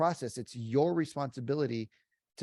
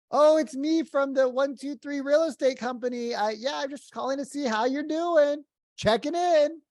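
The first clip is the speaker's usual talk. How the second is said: mock chirpy agent, much higher and louder; cheesy salesman; 13 semitones higher, 6 dB louder than usual. Agent answering the prospect: high, bright, fake-cheery check-in. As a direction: sell the fake cheer, pitched way up. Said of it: "And you're like".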